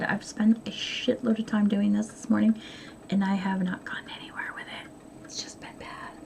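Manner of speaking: soft unvoiced confiding whisper; whispered aside. Real, not acted. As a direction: drop to a whisper, fading away. Whispers aside about wasted morning.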